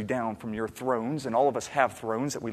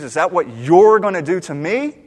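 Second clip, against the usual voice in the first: loud, raised, incredulous question; indignant challenge; 5 semitones higher, 13 dB louder than usual. Acted. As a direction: challenge him, building loudly to the end. Acts indignant listener challenging David.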